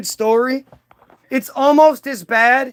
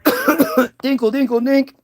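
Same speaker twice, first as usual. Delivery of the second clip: magic chime noise; 3 semitones higher, slower than usual. Retold or imitated. Magic sound effect.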